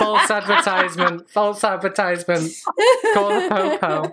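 high, laughing protest; laughing mock outrage. Joking about false advertising with co-hosts, laughing mock outrage.

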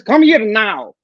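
lightly voiced Greek mama. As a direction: insist like a mama. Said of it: "with a mama voice".

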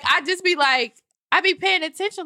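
ordinary delivery

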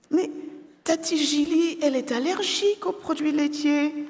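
put-on high child's voice pressing the point; protesting girl. A narrator's reading. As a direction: insist like a small girl. Sophie insists to dad.

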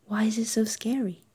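soft whispered question; a quiet breathy aside. Real, not acted. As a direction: hush it, as if asking yourself. Whispered question about her fear.

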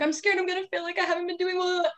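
mild imitation of a panicked student; scared, on the verge of tears. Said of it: "I'm about to cry".

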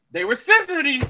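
ordinary delivery

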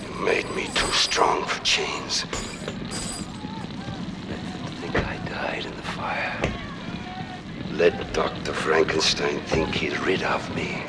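low, sinister threat; menacing vow. Acted. Monster freed from chains threatens his maker, menacing vow. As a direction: sink low and promise revenge.

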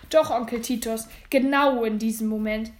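loud, resolute reply at normal pitch; determined, emphatic insistence; firm contradiction. Acted. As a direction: stand your ground firmly. Nephew firmly contradicts uncle.

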